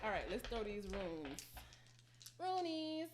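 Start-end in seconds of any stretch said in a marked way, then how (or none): 2.4-3.1 squeaky cute word. Pretend. Playful mispronounced runes.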